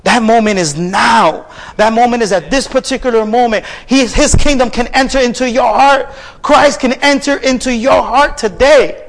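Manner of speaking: high, driving sermon delivery; full-force preaching. Real, not acted. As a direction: proclaim it to the congregation with fervour. Preaches climactic appeal.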